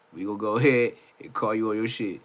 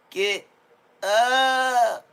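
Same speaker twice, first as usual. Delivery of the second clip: much higher, shouted [name] impression; 10 semitones higher, 6 dB louder than usual. Redoing the quote in [name]'s voice, hammed-up shout. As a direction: yell it like [name] hyping a crowd. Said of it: "You gotta do the Meek Mills voice".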